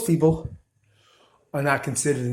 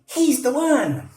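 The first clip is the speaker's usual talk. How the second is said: mock believer; 10 semitones higher, slower than usual. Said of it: "And you're gonna say".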